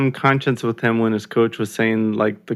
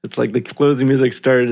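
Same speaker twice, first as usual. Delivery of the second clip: ordinary talk, nothing marked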